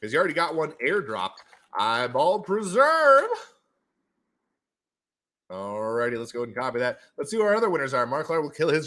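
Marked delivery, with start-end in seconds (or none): 1.8-3.3 high, slow drawn-out call; showy prize announcement. Acted. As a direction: stretch the prize name out, pitched up.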